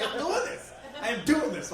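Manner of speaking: high, excited self-pep talk; pumped up. Retold or imitated.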